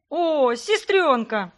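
loud, high, slow drawn-out cry; joyful wail. A narrator's reading. Greg spots his sister and runs to her, joyful loud cry. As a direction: howl it out with delight. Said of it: "возопил братик".

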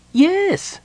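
high, loud cheerful kender. A narrator's reading. Kender answers Palin, high and cheerful. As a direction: chirp it out happily. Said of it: "said Tass cheerfully".

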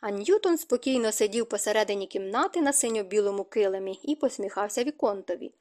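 usual speaking voice

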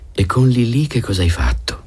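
a touch of playful Linda; Linda's voice. Acted. Linda teasing the narrator.

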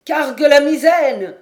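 loud, high command shout; shouted order. A narrator's reading. Captain to sailors in a rising gale, shouted order. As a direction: yell it across the deck. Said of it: "cria John Mangles".

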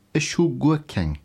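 usual speaking voice

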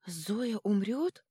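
slow, high horrified question. A narrator's reading. Girl fearing her friend will die, horrified. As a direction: ask it aghast, voice rising. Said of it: "ужаснулась Винья-Винья".

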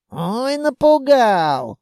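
high, slow drawn-out mock fright. A narrator's reading. Carter sneers at the elf's threat, mocking. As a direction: jeer with stretched vowels. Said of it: "Колобок скорчил рожу".

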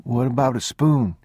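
put-on high child's question; small boy. A narrator's reading. Boy asking father for a spoon, high child voice. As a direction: ask Papa like a little boy.

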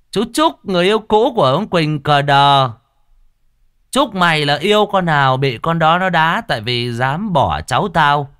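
loud, emphatic tongue-in-cheek cursing; mock indignant curse. Uncle cursing the ex who dumped his niece, mock outrage. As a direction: hurl a playful curse at top volume.

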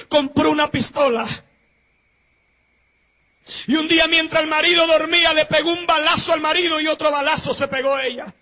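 loud, fast storytelling preacher; dramatic sermon story. Acted. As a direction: rush the tale at the congregation.

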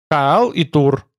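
measured, precise recital; crisply enunciated. A narrator's reading. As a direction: answer distinctly, word by word. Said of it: "отчеканил Тмор".

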